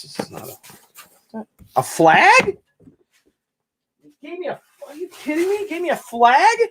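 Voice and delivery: high, incredulous question, voice jumping up; can't believe what's in the box; stunned disbelief. Real, not acted. Unboxing a gift from a friend's son, stunned and incredulous.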